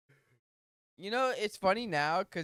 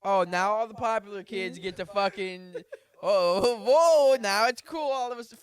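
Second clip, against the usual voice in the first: mock sarcastic background kid; 3 semitones higher, 8 dB louder than usual. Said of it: "you're like".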